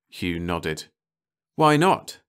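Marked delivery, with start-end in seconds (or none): 1.6-2.2 high, loud, rising question; eager. A narrator's reading. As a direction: challenge it eagerly. Hugh hopefully counters refusal.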